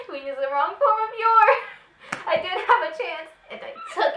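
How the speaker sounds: high, loud, laughing through the words; laughing mock outrage. Real, not acted. Two friends mocking old fanfic, laughing. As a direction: crack up while calling out the typo.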